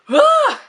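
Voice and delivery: a sharp exasperated cry; frustrated yelp. Real, not acted. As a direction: squeal at tripping over her own words. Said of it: "I can't talk".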